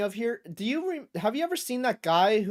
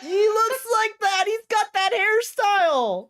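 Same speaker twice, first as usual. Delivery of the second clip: high, slow, loud exclaiming; gleeful high-pitched crowing; excited exclaiming; 14 semitones higher, 5 dB louder, slower than usual. Real, not acted. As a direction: point at him and crow with glee. Mocking excited about a man's haircut.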